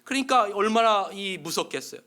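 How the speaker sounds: usual speaking voice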